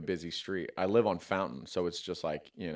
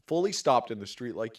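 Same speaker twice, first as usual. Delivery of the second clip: ordinary talk, nothing marked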